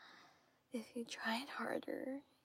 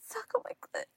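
much higher, louder command; high demand; 12 semitones higher, 9 dB louder, much faster than usual. Acted.

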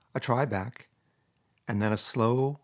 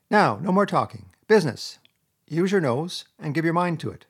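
brisk command; 6 semitones higher, 5 dB louder than usual. Acted. Rat takes command of search.

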